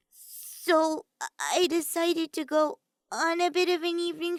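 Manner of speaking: nervous mage stammering her story; Himiko's voice. A narrator's reading. Himiko explains her night to suspicious friends, high and hesitant.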